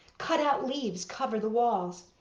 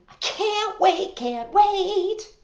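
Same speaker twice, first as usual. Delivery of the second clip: excited; 10 semitones higher, 5 dB louder, slower than usual. Acted. Excited child chanting.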